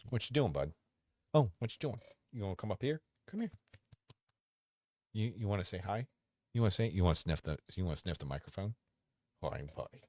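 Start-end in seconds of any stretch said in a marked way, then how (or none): 0.1-8.8 higher, sing-song voice for the dog; pet talk. Real, not acted. Owner coaxes his dog to the microphone, higher sing-song.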